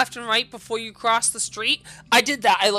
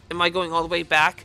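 usual speaking voice

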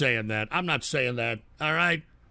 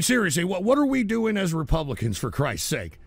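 normal talking voice

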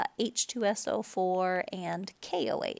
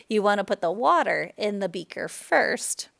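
louder, firm correction; "water" stressed, louder, setting it right; correcting himself; 8 dB louder than usual. Shown as a demonstration. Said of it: "now I'm saying it backwards".

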